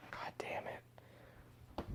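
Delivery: a real, exasperated whisper under the breath; whispered frustration. Real, not acted. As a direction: hiss it out, fed up. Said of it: "frustrated whisper".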